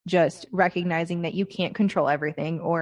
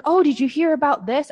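parent's eager question, higher and louder; imitating parents; 7 semitones higher, 5 dB louder than usual. S1 mimics parents excitedly asking about news, raised mock voice. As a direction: mimic the folks breathlessly sharing news.

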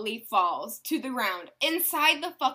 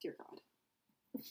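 whispered, much softer plea to God; exasperated whisper; 8 semitones lower, 16 dB quieter, slower than usual. Real, not acted. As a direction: breathe it out, worn down by her. Exasperated reaction to friend.

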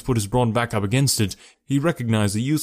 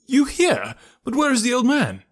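much higher, startled cry; astonished; 13 semitones higher than usual. Acted. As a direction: gasp it out in disbelief. Narrator astonished at Holmes.

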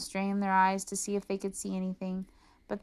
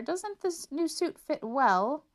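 pompous emperor; 8 semitones higher, slower than usual. Acted. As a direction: bluff approval, flustered.

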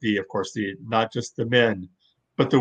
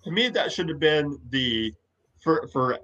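normal talking voice

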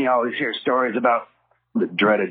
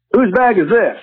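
a higher, louder barked question; demanding cop; 10 semitones higher, 6 dB louder than usual. Retold or imitated. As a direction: demand an answer like a highway cop. Cop searching the van.

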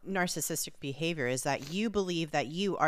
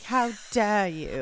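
higher, mock-affronted posh English; cut-glass English accent; 4 semitones higher, 5 dB louder than usual. Co-host as the accused man taking offence, mock cut-glass English accent. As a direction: act indignant in put-on posh English. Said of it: "it's called a cut glass English accent".

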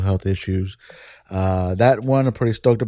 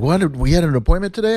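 higher, fast and alarmed; a flustered, startled blurt; panicked surprise; 7 semitones higher, faster than usual. Retold or imitated. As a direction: caught off guard by a forgotten appointment. Panicked at a missed appointment.

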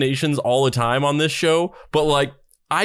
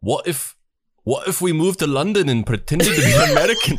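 attempted Scottish accent, breaking into laughter; bad Scottish accent; 2 semitones higher than usual. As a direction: have a shaky go at a Scottish accent. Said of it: "read that in a Scottish accent".

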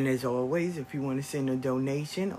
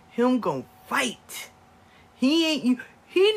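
loud and slow, higher, drawn out; rally the crowd behind him; fired-up; 8 semitones higher, 7 dB louder than usual. Real, not acted. Grandma bragging about grandson, loud and slow.

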